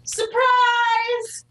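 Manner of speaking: high, sustained sing-out; playful high-pitched announcement; mock surprise reveal. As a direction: call it out high like a big reveal. Jokes as revealed character.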